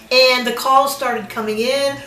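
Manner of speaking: normal talking voice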